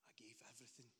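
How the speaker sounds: breathed out almost voiceless; whisper. Real, not acted. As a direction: let it fall to a hushed whisper. Solemn turning point in testimony.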